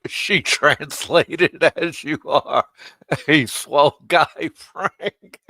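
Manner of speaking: slow words pushed through laughter; laughing. Real, not acted. Laughing while telling Frank the translation. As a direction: laugh your way through the line.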